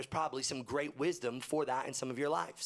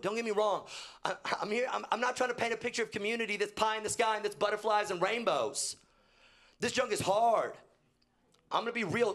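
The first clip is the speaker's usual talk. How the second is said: high, loud preaching push; fired-up preacher; preaching hard; 8 semitones higher, 3 dB louder than usual. Acted. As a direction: drive it home from the pulpit. Preaching at full force.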